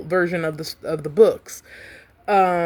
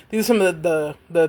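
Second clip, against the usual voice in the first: ordinary delivery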